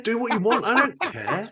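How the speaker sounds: high, laughing "do what you want"; playful high brush-off quoting yourself; mock breezy dismissal. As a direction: toss it off lightly, laughing. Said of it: "I said".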